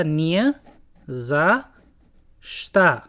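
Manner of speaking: "не за что" split slowly, each syllable set apart and stressed. Shown as a demonstration. Teacher slowing down each syllable for the class. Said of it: "तो ये जो ओ है, इसकी sound आ जैसी आने लगी".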